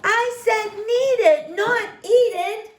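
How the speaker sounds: high, loud furious yell; angry shout. Acted. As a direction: shout at the dog in anger. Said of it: "shouted Henry".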